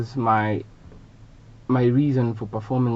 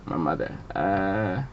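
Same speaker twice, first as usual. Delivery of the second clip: ordinary talk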